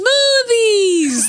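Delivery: very high, drawn-out yell; imitated movie shout. Showing a friend how the movie character says it, high shout. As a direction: shriek the word, stretched out. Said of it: "That's what she says".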